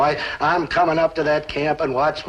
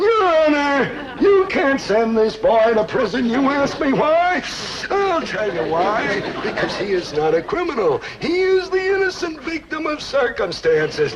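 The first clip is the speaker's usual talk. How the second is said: hammed-up sympathetic plea; 8 semitones higher than usual. Shown as a demonstration. Dad demonstrating a sympathetic plea to the judge, hammed-up. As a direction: plead like a melodramatic defense lawyer. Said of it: "use the sympathetic approach".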